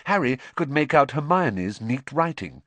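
plain reading voice

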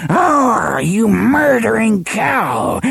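high, slow wail of rage; screaming woman. A narrator's reading. Woman in the hut curses her pet's killer, screaming. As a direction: screech it out high and drawn-out. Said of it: "screamed a woman's voice from the shadows".

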